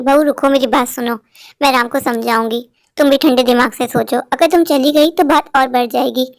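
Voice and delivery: a mother-in-law soothing her daughter-in-law; calm mother-in-law. Acted.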